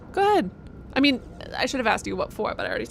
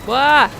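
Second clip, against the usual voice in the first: playful yelp; 3 semitones higher, 10 dB louder than usual. Real, not acted. Loud exclamation.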